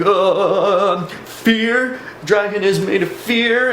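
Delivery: high, quavering mock-terrified voice; forced-out, high-pitched frightened answer, acted; imitated shaking fear. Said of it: "he starts to shake".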